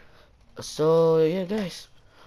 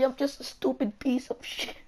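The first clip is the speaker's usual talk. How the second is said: whispered, higher, in mock shame; a mock self-pitying whisper; 6 semitones higher, 7 dB quieter than usual. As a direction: hiss it like a fake confession. Mock self-pity confession.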